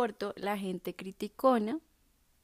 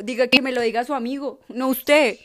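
mock indignant reply; 3 semitones higher, 7 dB louder than usual. Retold snappy reply at school.

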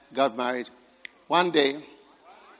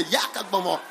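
lower, playful mock British accent on "Yakagbomo"; 6 semitones lower than usual. Shown as a demonstration. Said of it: "With a British accent, you can say".

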